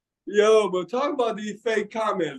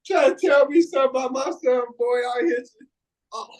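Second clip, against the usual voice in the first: laughing; 7 semitones higher than usual. Real, not acted. Mock threat while laughing.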